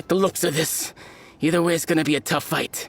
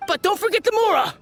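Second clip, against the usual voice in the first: shrill, much higher cry while running; panicked. Acted. Fleeing smuggler yelling not to leave the money, panicked. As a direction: squeal it in a hurry.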